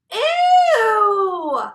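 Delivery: loud, high drawn-out ew; disgusted. A narrator's reading. Narrator seeing the hairy spider, a loud high disgusted ew. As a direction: recoil from the spider.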